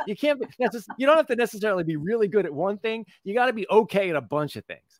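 high, amused voice after laughing. Real, not acted. Explains own joke after laughing.

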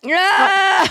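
high, delighted outburst; excited yes. Real, not acted. Co-host recognizes the commercial guy, excited. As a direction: burst out with a high, loud yes. Excited burst, then laughing.

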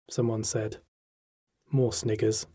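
ordinary delivery, nothing marked

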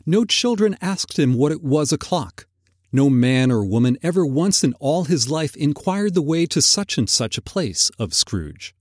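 usual speaking voice

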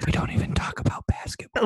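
voiceless joking whisper; ASMR whisper. Pretend. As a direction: keep the ASMR hush going. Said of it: "Just talk like this".

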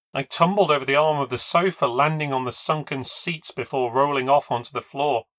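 ordinary delivery, nothing marked

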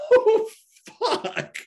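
very high, laughing squeal; helpless amusement. Retold or imitated. Patient overhearing absurd complaint through misery, mock helpless laughter. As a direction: re-enact laughter breaking through.

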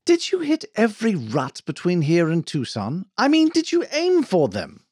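indignant shouted question; shouting. A narrator's reading. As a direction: shout the question at him, pitch climbing sharply. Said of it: "turned to Claude and shouted".

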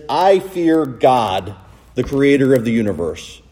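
very slow, weighty reverence; Jonah's slow reverent answer; slow solemn fear. Retold or imitated. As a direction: stretch out I fear God. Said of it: "Jonah says".

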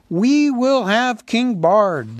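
slow, high, loud crowd demand; shouted reply. A narrator's reading. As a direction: shout it back, drawing out each word. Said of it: "shouted in reply".

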